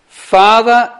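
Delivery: Father cried out high and loud; loud cry. Acted. Jesus on the cross calls out to his Father, loud cry. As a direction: call out to the Father with full voice. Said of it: "called out in a loud voice".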